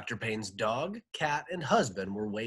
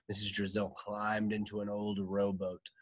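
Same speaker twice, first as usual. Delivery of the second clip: ordinary delivery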